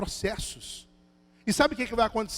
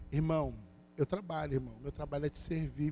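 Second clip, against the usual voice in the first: a touch of calm, slightly lower and softer; calm breath first; 7 semitones lower, 7 dB quieter than usual. Shown as a demonstration. Pastor shows the congregation how to calmly answer a mocker, soft and composed. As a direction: take a breath and answer gently. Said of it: "respirar assim e falar assim".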